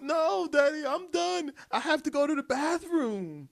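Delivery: put-on high, whiny child voice; little girl's voice. Dad imitating his daughter refusing to ride, high and whiny. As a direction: pitch up into a pouting little girl.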